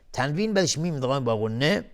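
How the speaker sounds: ordinary delivery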